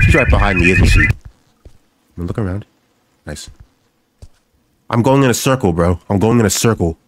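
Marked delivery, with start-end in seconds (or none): none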